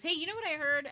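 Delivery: partner. Retold or imitated. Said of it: "he goes".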